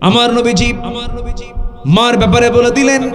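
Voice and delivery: high, loud exhortation easing down at the end; full-force preaching. Real, not acted. Preacher urging the congregation to pray on time, full-force preaching.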